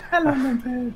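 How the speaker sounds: high, sing-song hello; cutesy greeting. Pretend. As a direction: greet it like a pet. Greets a drone.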